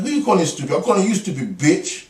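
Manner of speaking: high, loud, heated back-and-forth; acted quarrel. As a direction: play up a heated domestic spat. Acted quarrel with his wife.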